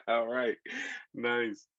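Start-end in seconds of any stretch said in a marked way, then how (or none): none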